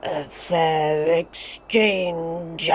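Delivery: deep, very slow, drawn-out menacing villain line; imitating the villain's voice. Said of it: "I absolutely love Miguel Zama's voice".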